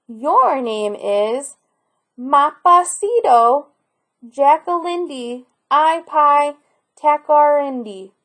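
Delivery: slowly, carefully pronouncing each part of the name; slow recital. Acted. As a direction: spell the name out slowly and proudly. Boy proudly recites the long name.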